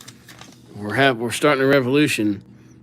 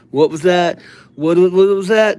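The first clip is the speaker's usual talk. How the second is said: high, louder mock disbelief; mock surprise; 5 semitones higher, 6 dB louder than usual. Reading a chat comment, mock surprise. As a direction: pretend he can't believe the comment.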